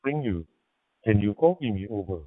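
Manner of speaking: ordinary delivery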